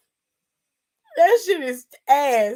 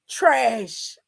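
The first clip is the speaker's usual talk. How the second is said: ordinary talk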